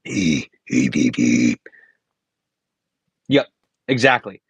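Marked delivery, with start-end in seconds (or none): 0.1-1.6 chest bass demo. Shown as a demonstration. Teacher demoing the plain chest bass for students. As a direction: lower beatbox chest bass sounds. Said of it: "regular vocalizing or chest bass".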